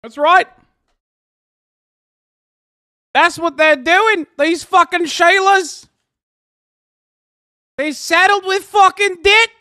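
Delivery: hammed-up Bill Burr rant. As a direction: rage at them like Bill Burr doing a bit. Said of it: "I'm turning into Bill, Bill Burr".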